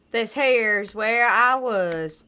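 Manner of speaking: put-on slow, loud southern accent drawl. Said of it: "with any kind of southern, uh, accent".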